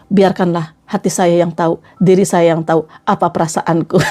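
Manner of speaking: low, mock-martyred brooding; hammed-up wounded heart, voice sunk low; silent suffering. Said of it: "Jangan kayak gini". (pura-pura merana, suara rendah)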